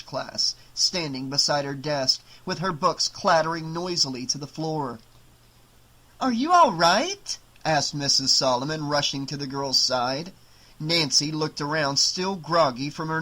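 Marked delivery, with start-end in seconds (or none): 6.2-7.4 high, slow, louder worried question; alarmed concern. A narrator's reading. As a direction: call out in concern, voice pitched right up. Teacher rushes to startled student.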